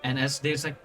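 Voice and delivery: normal talking voice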